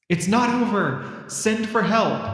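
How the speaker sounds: mild theatrical cry for help; mock distant shout. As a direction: call out as if from afar, held back. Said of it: "Doctor Maya's voice shouting in the distance".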